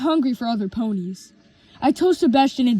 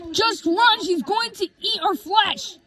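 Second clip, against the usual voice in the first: high, urgent yell of warning; 8 semitones higher than usual. A narrator's reading. Gray warns the others about Twilight, yelling high. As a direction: cry out for everyone to run. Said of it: "I yelled and spoke".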